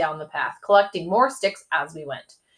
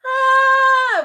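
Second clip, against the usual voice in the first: high loud panicked scream; 7 dB louder than usual. A narrator's reading. Boys fleeing the bee swarm, screaming. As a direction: let out a terrified scream. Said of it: "we both screamed".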